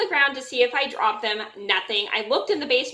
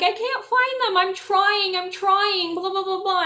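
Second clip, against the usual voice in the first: much higher, panicky mock plea; distressed plea; 9 semitones higher than usual. Retelling pleading with her angry mom, high and panicky. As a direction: squeak it out like a flustered kid in trouble. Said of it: "I'm like".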